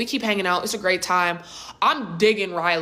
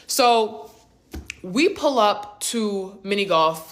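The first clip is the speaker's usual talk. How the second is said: ordinary talk, nothing marked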